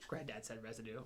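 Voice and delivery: low, hushed imitation of a little boy; gleeful child tattling. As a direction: mimic a kid reporting the forbidden word. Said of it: "He's like".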